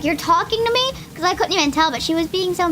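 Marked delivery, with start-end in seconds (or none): 0.0-0.9 higher, puzzled quote; retelling her startled reply to the lunch lady; mock surprised. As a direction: mimic her own confused question. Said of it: "And I was like".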